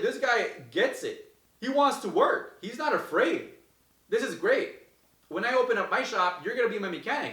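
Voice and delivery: recalling praise for his mechanic, excited and high. Retold or imitated. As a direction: rave about this guy.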